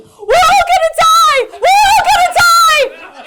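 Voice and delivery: put-on high-pitched, full-force panicked child's shriek; daughter screaming. Mother acts out daughter waking on the plane, screaming. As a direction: shriek it out like a terrified kid. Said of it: "she starts screaming".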